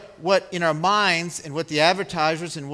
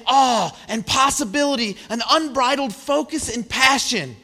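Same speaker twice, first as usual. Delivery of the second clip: higher, louder, building sermon passion; rising preaching; 6 semitones higher, 4 dB louder than usual. Real, not acted. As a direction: lift the congregation with growing fervour. Preaching climax about wonder.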